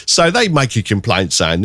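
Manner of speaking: ordinary delivery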